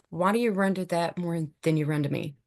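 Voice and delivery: ordinary delivery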